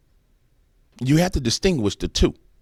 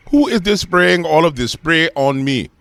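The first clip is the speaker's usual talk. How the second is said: higher, louder, slow put-on African accent; exaggerated African accent, indignant and slow; outraged African accent; 7 semitones higher, 7 dB louder, slower than usual. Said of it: "switch to my African voice".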